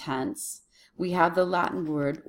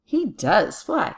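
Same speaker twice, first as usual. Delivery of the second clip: slow, punchy stress on DOES; insistent weight on DOES; emphatic DOES; 3 semitones higher, 4 dB louder, slower than usual. Shown as a demonstration. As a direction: hit "does" hard, then a short "fly". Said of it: "Can you see the emphasis?".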